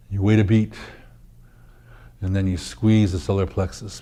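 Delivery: ordinary talk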